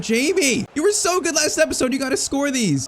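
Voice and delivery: high-pitched, dismayed scolding; exasperated pleading. Real, not acted. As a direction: plead with the striker after his miss. Striker misses the chance.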